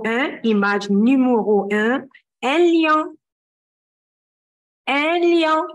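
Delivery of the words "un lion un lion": raised model word. Shown as a demonstration. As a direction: call it out for them to repeat. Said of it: "كلنا نقول ورايا عشان نعرف ننطق". (بصوت أعلى عشان يرددوا وراها)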